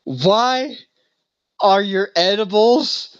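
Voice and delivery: high, slow, pleading imitation of a crying man. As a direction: drag out a tearful desperate question. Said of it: "he is just like".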